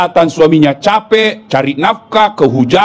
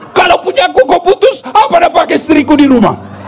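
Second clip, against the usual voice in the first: raising his voice higher and higher in outrage; husband yelling at the electricity officer over the cut power; angry shouting husband; 5 semitones higher than usual. Acted. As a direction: demand it furiously, then shout.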